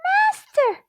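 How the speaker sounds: very high, loud magic-harp cry; shrieking alarm call to the giant. A narrator's reading. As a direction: call out in alarm for your master. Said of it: "the harp cried".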